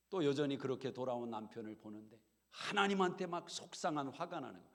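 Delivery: ordinary talk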